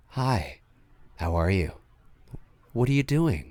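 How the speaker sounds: low, soft, even phone voice; calm and quietly creepy, nothing overbearing; subtle menacing caller. Retold or imitated. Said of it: "you just hear him say".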